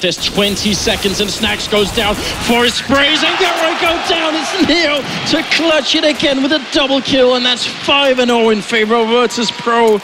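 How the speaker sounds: shouting, high caster call; esports caster. Acted. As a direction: scream the clutch play. Live play-by-play shouting.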